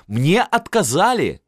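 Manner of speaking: high, drawn-out elated announcement; happy cry. A narrator's reading. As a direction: proclaim it joyfully, stretching the words. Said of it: "закричал он счастливый". (радостно, высоко, протяжно)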